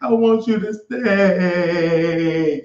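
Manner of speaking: high, slow little girl pleading, imitated; mock crying child. Uncle imitating his crying niece at the airport, pleading. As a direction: whimper it like a niece begging her uncle. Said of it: "Anaya would cry".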